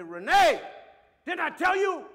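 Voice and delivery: sudden furious shout, high and loud; a father's yell at his small daughter; angry yell. Retold or imitated. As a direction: snap at her with full force.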